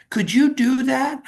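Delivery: lightly voiced grateful old woman; a hint of a surprised elderly lady. Old lady offered tire help.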